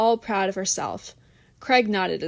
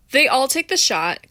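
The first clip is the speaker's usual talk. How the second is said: ordinary delivery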